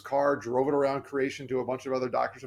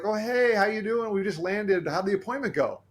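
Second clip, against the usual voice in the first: much higher, bright and bubbly greeting; chipper mom; 7 semitones higher than usual. Retold or imitated. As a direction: play the cheerful mom calling right after landing. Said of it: "my mom's a pretty chipper, happy person".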